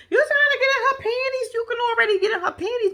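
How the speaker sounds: high, incredulous teasing; teasing accusation. Pretend. Storyteller teasing man pouring giant glasses, incredulous. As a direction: pitch it up and call him out.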